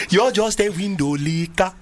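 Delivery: put-on sing-song Nigerian accent. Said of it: "in a Nigerian accent".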